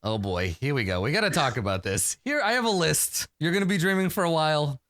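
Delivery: normal talking voice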